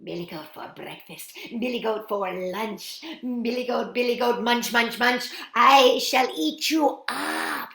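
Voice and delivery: troll frenzy of hungry glee; excited greedy troll. A narrator's reading. Troll excited, chanting his menu.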